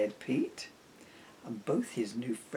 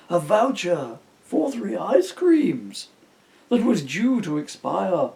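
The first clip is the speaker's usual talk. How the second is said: slow, loud, grand stage delivery; thespian; 5 semitones higher, 9 dB louder than usual. Acted. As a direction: ham it up like a classic stage actor. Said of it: "I'm getting all thespian-y here".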